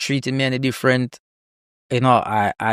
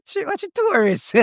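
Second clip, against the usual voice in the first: very high, teasing local voice; amused locals. Retold or imitated. Locals in a shop ribbing a tourist, high teasing voice. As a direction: tease the tourist playfully. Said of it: "everybody like".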